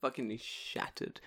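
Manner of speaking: ordinary talk, nothing marked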